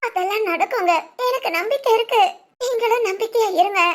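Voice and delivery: crying while trying to sound hopeful; tearful. Acted. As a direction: insist on hope through tears. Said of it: "எதுக்கு இப்ப எல்லாரும் அழுதுட்டு இருக்கீங்க".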